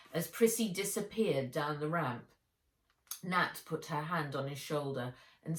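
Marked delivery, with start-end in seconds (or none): none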